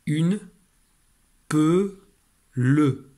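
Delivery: choppy decoding of une peu le; syllable by syllable. Shown as a demonstration. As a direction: split into separate beats, une, peu, le. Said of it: "Ça donne".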